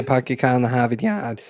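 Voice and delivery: R-dropping Massachusetts accent. Shown as a demonstration. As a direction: drop every R like a Boston-area local. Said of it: "my Massachusetts accent might come out".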